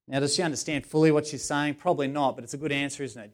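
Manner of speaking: ordinary talk, nothing marked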